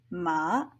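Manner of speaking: "ma" dipping low and soft; Mandarin third tone. Shown as a demonstration. Teacher demonstrating third tone "ma", low dip. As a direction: say "ma" dipping down then back up. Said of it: "they should sound something kind of like".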